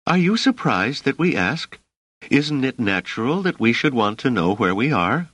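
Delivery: stiff challenge. A narrator's reading. Dors stiffened, challenges hostess.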